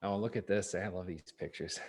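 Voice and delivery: ordinary delivery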